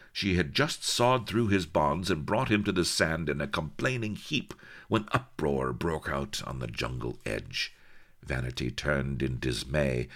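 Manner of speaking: ordinary delivery, nothing marked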